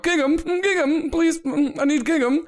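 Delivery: high, desperate calling madam. Acted. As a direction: call out high for the butler. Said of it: "this voice calling out".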